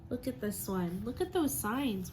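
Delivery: ordinary talk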